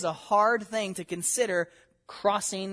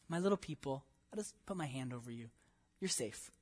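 much softer, lower, gentle; tender hush; 6 semitones lower, 15 dB quieter than usual. Acted. God reassures his little people, very soft and tender. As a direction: hush it like covering a child.

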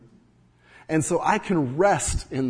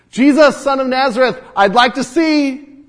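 calling out; 9 semitones higher, 10 dB louder than usual. Pretend. Imagined plea of blind man.

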